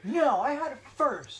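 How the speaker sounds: put-on high, loud, slow whiny kid; spoiled kid refusing to share; bratty child. Children quarrel over toy.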